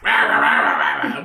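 very low guttural gibberish; goblin chatter. Acted. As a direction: growl out low monster babble. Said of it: "And they're like".

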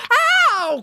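high, loud yelp of pain; sudden drawn-out "ow" yelp; pained cry. A narrator's reading. Officer is bitten on the shin, pained cry. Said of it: "cried the animal control officer".

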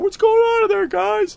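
high sing-song call through the door; lightly eerie voice calling in; high what's going on in there; creepy roommate. Retold or imitated.

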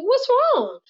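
put-on high, small boy's question; little son asking his upset mom what's wrong; child son's voice. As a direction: ask it like a worried little kid. Son checking on crying mom.

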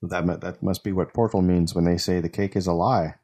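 ordinary talk, nothing marked

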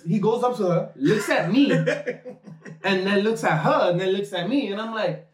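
usual speaking voice

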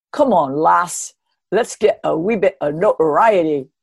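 Scots brogue. Retold or imitated. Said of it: "in his Scots brogue, I can't do one, but I'll try".

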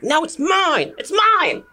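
high-pitched frantic yell; fighting over it. Acted. Game master as a villager fighting over a token, frantic yell. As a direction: yell it high, like someone in a scuffle. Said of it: "You can hear somebody fighting".

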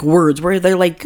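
usual speaking voice

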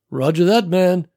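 easygoing reply over the radio; casual buddy voice. Acted. Walt answers over walkie.

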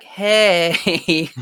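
high, drawn-out sing-song greeting; mock announcement. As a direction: sheepishly break big news in a singsong. Acts out surprise pregnancy announcement.

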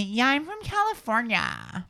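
slow, high California accent; West Coast accent. Shown as a demonstration. Host models slow West Coast accent for Natalie. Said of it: "West Coast".

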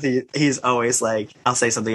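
usual speaking voice

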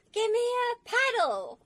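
high, loud, drawn-out mock yell; imitated shout. Woman retelling how Demi yelled for a paddle, shouting. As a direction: holler it slowly, imitating a sailor in a panic. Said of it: "he shouts something like".

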